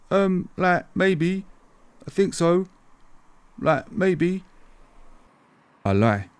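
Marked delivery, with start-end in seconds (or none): 0.1-4.4 nervous, higher pitched schoolboy; voice going up. Retold or imitated. Scared schoolboy answers the rude boy, voice going up. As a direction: let the voice jump up in panic. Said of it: "My voice goes up an octave".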